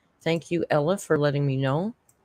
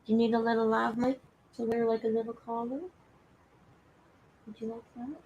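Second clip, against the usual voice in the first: high, soft cooing pet voice; baby talk; 6 semitones higher, 7 dB quieter than usual. Acted. Streamer asking her cat in baby talk if he wants a collar. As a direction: coo the question up high.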